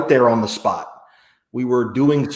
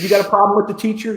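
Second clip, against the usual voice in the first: strict mother; 10 semitones higher, faster than usual. Retold or imitated. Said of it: "She said".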